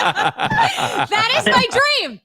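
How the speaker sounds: laughing. Real, not acted. Laughing about her dream.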